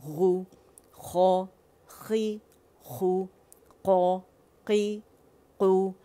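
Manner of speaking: syllables sounded from the far throat; letter drill. Shown as a demonstration. Teacher drilling students, throat syllables. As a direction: model each syllable crisply. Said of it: "far throat से".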